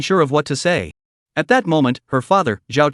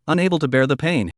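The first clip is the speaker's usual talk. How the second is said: usual speaking voice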